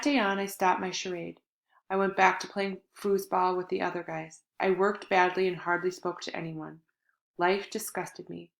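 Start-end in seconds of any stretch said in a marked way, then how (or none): none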